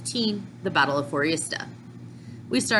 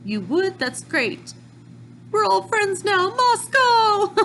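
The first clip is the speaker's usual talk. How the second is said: excited imitation; 12 semitones higher than usual. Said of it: "And then Khalid's like".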